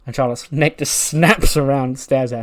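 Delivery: normal talking voice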